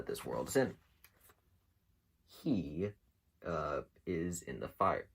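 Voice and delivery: ordinary talk, nothing marked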